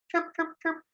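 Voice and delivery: very high squeaky chirp chirp; cricket chirps. Shown as a demonstration. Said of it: "The cricket who chirps".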